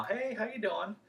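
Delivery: bright high cheery hello to a stranger; cheesy friendly greeting. Shown as a demonstration. Salesman greeting homeowner at door, beaming cheerful hello. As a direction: greet with a big cheesy smile. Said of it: "give them a big cheesy smile".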